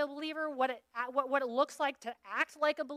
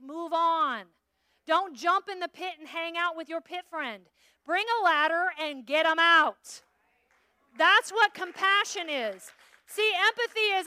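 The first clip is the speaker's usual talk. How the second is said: loud preaching; 4 semitones higher, 7 dB louder, slower than usual. Acted. Preaching loudly.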